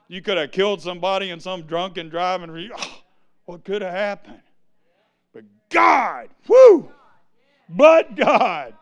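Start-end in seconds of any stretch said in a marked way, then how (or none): 5.3-8.7 fervent exclamation. Real, not acted. Preacher exulting to the congregation in loud praise. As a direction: shout it out, rising to a whoop.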